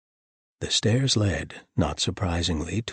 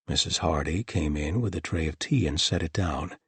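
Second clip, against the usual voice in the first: usual speaking voice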